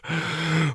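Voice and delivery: put-on short cackling laugh of an old man; old man's chuckle. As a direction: chuckle at your own joke. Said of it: "no such old bones as mine".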